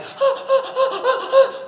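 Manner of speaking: a sharp, very high mock gasp; high squeaky gasp. Mimicking a woman's voice in bed, high squeaky gasp. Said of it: "Either goes like".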